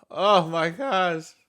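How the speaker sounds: drawn-out, high oh my gosh; overwhelmed. Real, not acted. Reactor imagining a sea of fans, overwhelmed. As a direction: marvel slowly, pitch lifted.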